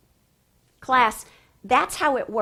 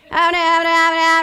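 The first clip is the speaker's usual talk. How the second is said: loud, high droning read-aloud; 6 semitones higher, 10 dB louder than usual. Shown as a demonstration. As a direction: blare it out like mechanical reading. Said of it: "this is her reading voice".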